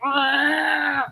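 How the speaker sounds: a mock cat's yowl. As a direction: yowl like a cat wanting out. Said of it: "he'll be like".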